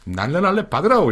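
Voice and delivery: put-on shouting orders in a North Korean accent. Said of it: "그 캐릭터를 연기하면서".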